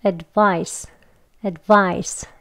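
"advice" with a faint 드 as the tongue leaves; 살짝 "드" 소리가 나는 "advice"; careful demo. Shown as a demonstration. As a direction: let a light d slip out between "ad" and "vice". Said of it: "살짝 드 소리처럼 나는".

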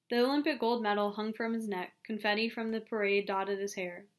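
usual speaking voice

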